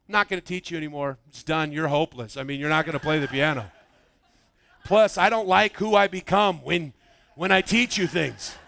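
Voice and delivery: worn-out mom telling her son she's quitting piano lessons; resigned giving up. Retold or imitated. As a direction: sigh it out like you're done with him. Mom quits teaching, resigned.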